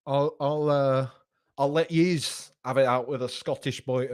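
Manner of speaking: loud, hammed-up Scottish accent; attempted Scottish accent. Host answering a viewer's dig at Scottish football, attempted Scottish accent. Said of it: "I thought I'd be able to do a Scottish accent".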